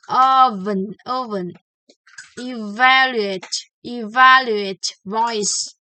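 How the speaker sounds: loud, every V buzzing on the lip; clear V words. Shown as a demonstration. Teacher drills the V words, loud. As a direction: buzz each V. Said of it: "Let's practice some word in the V sound".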